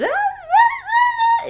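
very high yappy dog yips; squeaky high mock dog barks; imitating dog barking. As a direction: yap like an excited little dog. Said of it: "because he likes to talk".

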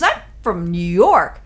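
indignant outburst running off into blah-blah; imitated exploding. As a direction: act out a drunk New Yorker blowing up. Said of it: "he's, like, exploding".

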